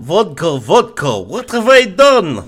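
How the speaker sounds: high, loud mock Russian lament; Russian accent. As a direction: wail it like a hammed-up Russian. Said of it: "accent heavy episode".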